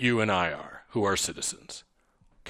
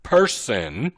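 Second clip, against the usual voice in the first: person split into two weighty beats; stress on person. Shown as a demonstration. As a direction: stretch out the word person. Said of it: "the reason I emphasize the word".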